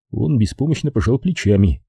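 plain reading voice

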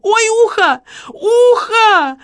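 high, loud shriek; cry of pain. A narrator's reading. Lola poked with pistol in the ear, shrieking. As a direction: scream out as if hurt. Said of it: "Лола вскрикнула".

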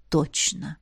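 low, hushed confirmation; quiet assurance. A narrator's reading. Man assuring the drowsy girl, quiet confident answer. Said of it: "уверенно ответил".

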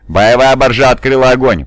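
shouted alarm report. A narrator's reading. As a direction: call it out across the bridge. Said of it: "прокричал один из офицеров". (тревожный доклад криком)

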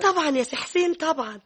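eager insistence. Acted. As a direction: jump in eagerly to convince him. Wife eagerly insists to husband.

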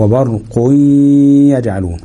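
"wa barquy-yaj'alun" with a long hummed y; yaa merged. Shown as a demonstration. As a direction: hold a nasal y from وبرق into يجعلون. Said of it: "واضغم الياء في الياء".

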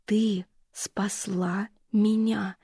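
ещё медленнее, беззвучный шёпот; растяни шёпот, утешая; нежный шёпот. Acted. Мать повторяет шёпотом. (мать очень медленным шёпотом утешает дочь)

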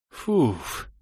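протяжное «фуф» на выдохе; шумно выдохни, отпуская напряжение; облегчённый выдох. A narrator's reading. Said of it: "выдохнул я". (выдох облегчения)

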